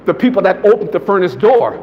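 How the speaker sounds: normal talking voice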